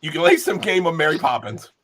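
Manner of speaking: ordinary talk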